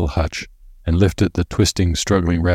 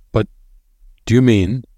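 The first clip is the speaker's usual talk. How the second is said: anxious; 5 semitones higher, 3 dB louder than usual. A narrator's reading. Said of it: "with an anxious little frown".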